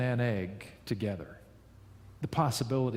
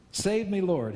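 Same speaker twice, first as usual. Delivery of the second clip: a mild cry for help; understated shout of panic; shouted plea; 6 semitones higher than usual. A narrator's reading. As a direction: call out to be saved, lightly. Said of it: "he shouted".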